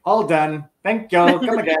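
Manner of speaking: mock-chipper wife saying come again, rising to a laugh. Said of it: "And she's like".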